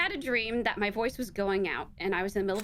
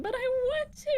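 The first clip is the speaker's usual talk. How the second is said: whiny plea. Retold or imitated. Retelling pleading with doctor.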